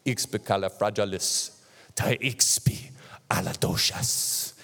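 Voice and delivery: slow, dramatic African accent, half whispered. Shown as a demonstration. Preacher showing how an African would say it, in a slow, dramatic African accent. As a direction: draw it out grandly in an African accent. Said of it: "when an African says it, it's like".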